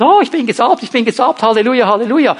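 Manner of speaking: preacher mocking someone proud of their anointing, smug sing-song; smug mock boast. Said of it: "wenn du die nur für dich hast und sagst".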